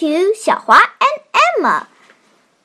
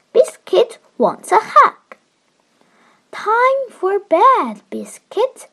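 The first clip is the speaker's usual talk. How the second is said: put-on reading to a toddler; 5 semitones higher than usual. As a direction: read it gently to a little one. Child reads picture book aloud.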